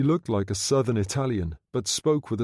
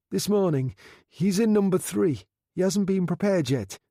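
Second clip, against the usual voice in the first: higher, anxious voice; nervous; 8 semitones higher than usual. A narrator's reading. Frightened undertaker answering his boss nervously. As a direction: answer quickly, scared.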